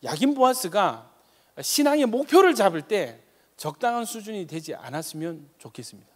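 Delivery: fervent sermon, rising to loud cries; impassioned pulpit preaching. Acted. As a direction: preach it at full force to the congregation.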